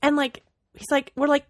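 normal talking voice

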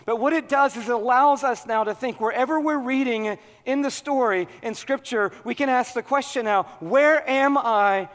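loud, high, impassioned sermon; preaching at full force. Acted. Pastor urging the congregation at full force, preaching. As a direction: preach it hard to the crowd.

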